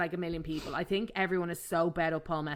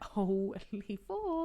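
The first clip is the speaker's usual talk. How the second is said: awestruck; 6 dB quieter, much slower than usual. Retold or imitated. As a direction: let the second word float up in awe. Reacting to hot actors.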